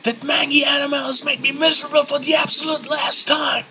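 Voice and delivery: high-pitched enraged dad shouting; furious scream. Acted. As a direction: scream it like a father at breaking point. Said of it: "Your father becomes furious. He screams".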